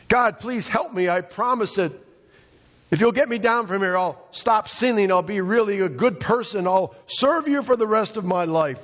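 high-pitched, hurried promises; pleading stranded climber. Acted. Jack begging God to get him down, pleading. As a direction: plead up high, piling on the vows.

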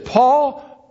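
high ringing call of the name; voice of the Lord calling. Acted. Divine voice calling Paul on the road, high ringing call. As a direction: call out from heaven, pitched high. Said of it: "And the voice says this".

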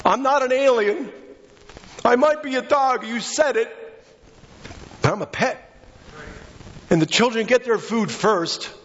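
high, pleading preacher voice on the woman's words; impassioned sermon plea. Acted. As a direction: plead her case from the pulpit.

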